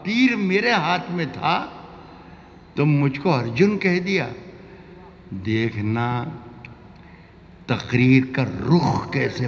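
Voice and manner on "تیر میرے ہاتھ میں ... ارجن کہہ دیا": ghazal recitation. Acted.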